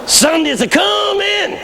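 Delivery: high, slow shouted refrain; climactic preacher's cry. Retold or imitated. Old pastor answers despair with his line, shouting high. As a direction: stretch the promise out at full cry.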